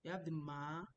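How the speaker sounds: usual speaking voice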